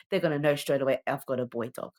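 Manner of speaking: usual speaking voice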